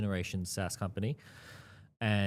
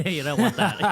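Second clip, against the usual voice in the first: laughing; 10 semitones higher, 12 dB louder than usual. Real, not acted. Laughing at his old mistake.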